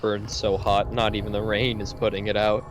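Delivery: measured narrator voice; story narration. Acted. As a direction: read it like game text. Reads game text aloud.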